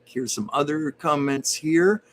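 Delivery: normal talking voice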